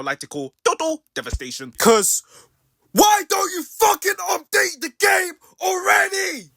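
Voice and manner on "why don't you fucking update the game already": high furious yell, slow; angry shout. Real, not acted. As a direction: yell it at the developers. Yelling at developers.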